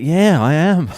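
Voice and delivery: imitated high, loud, eager reply; excited confirmation. Imitating a fellow Australian met at the park, excited. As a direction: raise pitch and volume, playing her delighted answer.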